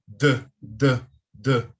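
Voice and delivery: a firm voiced D, D, D; firm D sound. Shown as a demonstration. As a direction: buzz a firm D. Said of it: "que é um T H mais firme".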